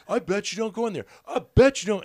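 high, loud taunt; taunting yell. Retold or imitated. Biker daring the comic, high and loud. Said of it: "he was just like".

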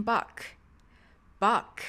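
バック said as in English, ending in K; English Bach. Shown as a demonstration. Teacher repeats composer's name after native clip, English-style バック. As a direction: close バック on a K sound. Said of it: "と英語では発音されます".

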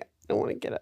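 whispered, barely voiced reluctance; retold feeble whine. Retelling herself hungover in bed, feeble whisper. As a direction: whisper it as if too ill to move.

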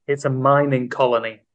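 usual speaking voice

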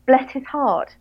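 high English bless his heart, sounding sarcastic; bless, said in a British tone that sounds insincere. Shown as a demonstration. English cohost trying the Southern phrase, sounding sarcastic. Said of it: "doesn't sound at all sincere".

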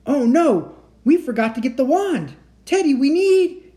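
high, loud alarmed cry; panicked shout. Acted. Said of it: "Jack shouted".

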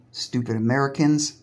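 ordinary talk, nothing marked

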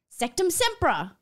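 high, loud curse; spell shout. A narrator's reading. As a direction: hurl the spell at him. Casting spell.

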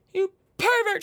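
high, loud hammed-up shriek of you pervert. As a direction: shriek pervert in playful outrage.

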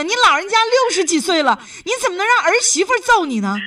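real incredulous telling-off; exasperated reproach. Real, not acted. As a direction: take her to task in disbelief. Host again reproaches elderly caller.

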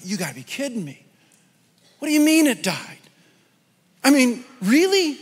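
mock outraged store manager, rising high and loud; shocked disbelief. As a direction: play the manager's stunned disbelief. Manager reacts to dead parrot.

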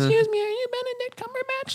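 put-on very high, squeaky child voice; little kid. Little kid pulling on his tailcoat, high falsetto. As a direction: pipe up in a tiny falsetto. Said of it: "a little, little kid".

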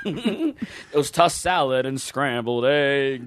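laughing through a sung-like quote; slow, giggling line. Pretend. Quoting the Frasier theme, laughing.

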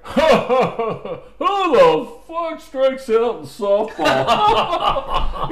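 high, very loud, drawn-out jeer; mocking heckle. Dad riding his son after a strikeout, jeering shout. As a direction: imitate a dad's booming taunt with scornful fake laughs. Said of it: "He's fucking riding me".

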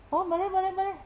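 put-on high, cheery old man's voice; hearty old man. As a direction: wave them in warmly as the old boss. Bob Hasan welcoming visitors in jail. (suara bapak tua yang riang, tinggi)

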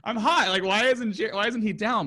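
put-on high, laughing girl voice. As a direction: imitate her through laughter. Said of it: "she's like".

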